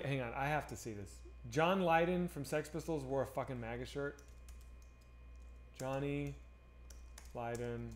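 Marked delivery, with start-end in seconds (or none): 5.8-7.9 muttered much softer and lower, in stunned letdown; low, deflated, can't believe it; dismayed disbelief. Real, not acted. Streamer reading news about his punk hero, soft stunned dismay.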